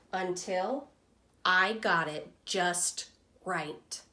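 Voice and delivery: lively storybook reading voice for a class; reading to children. Acted. Teacher reading Humpty Dumpty's story to students, lively storytelling voice. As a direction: read it like a picture book to young kids. Teacher reads picture book aloud.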